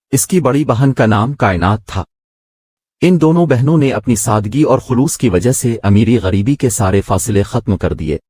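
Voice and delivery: usual speaking voice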